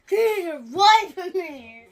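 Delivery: slow playful yell. Pretend. Repeated slowly, louder.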